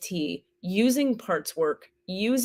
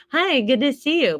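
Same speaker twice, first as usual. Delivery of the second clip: higher, bright put-on politeness; sweet social mask, higher and louder; fake cheerful greeting; 7 semitones higher, 5 dB louder than usual. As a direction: sing it out with a forced smile. Fake smile greeting outside.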